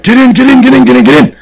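loud and high, rapid-fire get in; urgent shouted order. Retold or imitated. Cop urging the ride-along guy into the car, urgent shout. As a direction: bark it like there's a fire under the car.

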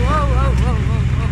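excited wows. Real, not acted. Excited at the scenery.

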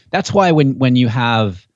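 ordinary talk, nothing marked

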